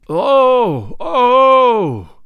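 high, loud, groaning cry of amazement; loud groaning oh of awe; astonished groan. A narrator's reading. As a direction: moan it out as a stunned crowd.

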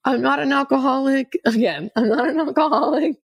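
retold hysterical crying. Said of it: "like, hysterically crying".

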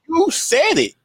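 very high-pitched, incredulous retort; exasperated accusation. Pretend. Analyst reminding absent player of his own words, exasperated.

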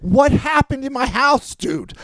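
loud, high, drawn-out demand; acted calling out. Husband calling across the pool to the tenant, acted incredulous shout. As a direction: holler it as the upset husband.

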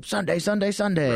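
imitating a blaring truck commercial; monster truck ad. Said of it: "I did a monster truck commercial".

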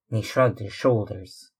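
plain reading voice

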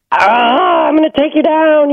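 high-pitched, hammed-up angry outburst; mock defensive anger. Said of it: "and defensiveness. Like".

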